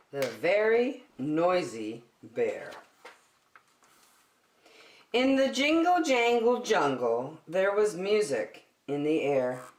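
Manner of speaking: loud, lively storybook reading; reading to children. Acted. Teacher reading a picture book to children, loud and animated. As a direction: read it out big for a room of kids.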